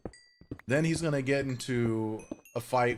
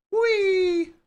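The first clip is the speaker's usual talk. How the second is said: very high loud squeal of fun; gleeful whee; 10 dB louder than usual. Real, not acted. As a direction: squeal with glee. Playful jump squeal.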